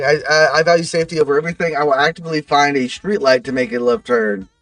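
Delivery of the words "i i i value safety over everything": rushed read-out. Shown as a demonstration. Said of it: "to redeem something".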